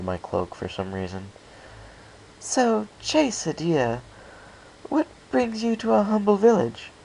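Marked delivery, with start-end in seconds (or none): 2.4-6.9 flirty Rarity. Acted. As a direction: coax the newcomer sweetly.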